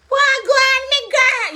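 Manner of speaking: very high, loud Jamaican patois; Jamaican patois greeting. Acted. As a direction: shout a delighted patois greeting to a friend. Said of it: "but when them reach home now".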